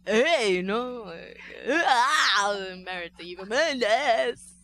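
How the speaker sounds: loud, hammed-up mock tough-guy accent; big, drawn-out caricature voice; Ryan Gosling. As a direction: exaggerate it into near Christopher Walken. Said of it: "that's more Christopher Walken".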